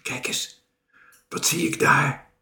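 urgent stage whisper. A narrator's reading. Mariska spots something by a tree, whisper. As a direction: whisper it as a secret discovery. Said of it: "fluisterde Mariska".

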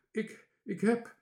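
high, stammering little voice; pale, faltering. A narrator's reading. Seasick Bange Toontje tries to report, faltering. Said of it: "zei hij bleekjes".

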